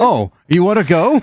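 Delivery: loud, high-pitched fighting dare; hammed-up creature picking a fight; mock challenge. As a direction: square up for a playful scrap. Creature challenged to fight after a slap.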